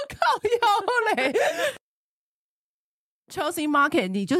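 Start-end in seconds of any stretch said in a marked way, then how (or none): 0.1-1.3 slow, high, drawn-out wailing cry; mock outraged cry. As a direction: howl it in fake outrage. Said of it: "他说".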